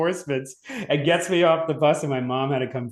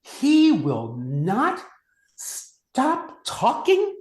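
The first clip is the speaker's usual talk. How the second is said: slow, drawn-out stern nun voice with a high last word; hammed-up scolding nun, stretching every word; mock angry nun; 9 semitones higher, much slower than usual. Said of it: "And Sister Adelaide's like".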